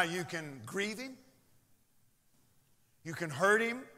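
ordinary talk

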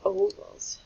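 OVALS with long O, as oh-vals. Shown as a demonstration. Teacher confirming the answer for second graders, long-O pronunciation. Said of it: "will be a long".

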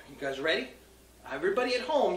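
ordinary talk, nothing marked

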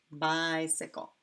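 bicycle said slowly with long I drawn out; slow teaching word. Shown as a demonstration. Teacher slowing bicycle so kids hear the I. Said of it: "that long I".

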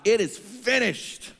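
high, slow cry of authority; victorious yell. Retold or imitated. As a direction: proclaim it like a victor. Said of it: "the power and the authority that Jesus yelled out".

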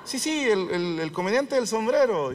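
high, stammering mock-fan voice; gushing classmate. Old classmate trying to place the comedian, gushing.

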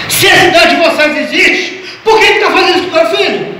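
retold angry challenge. Son-in-law challenging the preacher about his sick son, retold angry challenge. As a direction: demand an answer, preacher-style.

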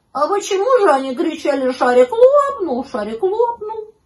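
high, loud puzzled question; astonished little pup; surprised puppy. A narrator's reading. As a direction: wail the quoted cry in bewilderment. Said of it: "удивился щенок".

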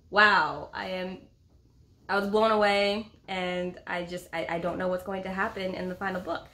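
normal talking voice

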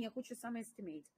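usual speaking voice

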